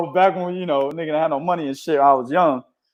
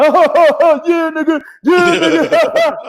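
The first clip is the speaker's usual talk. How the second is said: high, loud, slow laughing taunt; mocking friend; 12 semitones higher, 5 dB louder, much slower than usual. Friend at the window mocking the grounded kid, laughing. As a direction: stretch the taunt out through a laugh.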